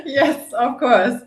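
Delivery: slow, laughing yes, of course; laughing reply. Real, not acted. Guest to host, amused by the greeting, laughing slowly.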